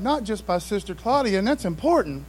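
ordinary talk, nothing marked

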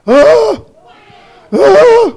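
high, loud mock scream; demonstrated cry. Shown as a demonstration. Preacher showing congregation how the disciples cried out, screaming. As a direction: lead the crowd in a hammed-up shriek. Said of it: "All God's people went".

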